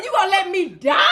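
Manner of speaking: high, laughing mock shout; dramatic plea. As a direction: overplay the dying drama. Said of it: "I said".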